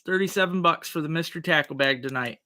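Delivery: normal talking voice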